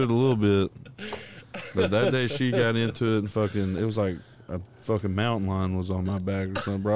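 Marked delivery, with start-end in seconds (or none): none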